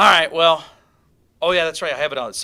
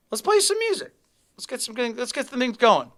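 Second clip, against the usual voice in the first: excited; 10 semitones higher than usual. Acted. Hyped announcing music.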